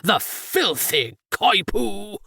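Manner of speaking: slow, drawn-out indignant rage; raging. A narrator's reading. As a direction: spit out each word in outrage. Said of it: "My father raged".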